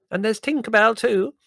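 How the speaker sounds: put-on an effusive old aunt greeting; elderly aunt voice. A narrator's reading.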